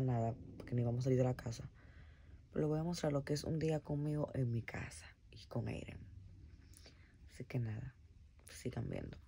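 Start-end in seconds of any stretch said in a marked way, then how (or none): 2.5-9.2 whisper. Real, not acted. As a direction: hush it so he stays asleep. Whispering so baby keeps sleeping. (susurrando para no despertar al bebé)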